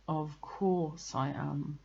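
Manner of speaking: slow, resigned Eeyore; glum reply. A narrator's reading. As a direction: answer heavily, faintly put out. Eeyore answers Pooh glumly.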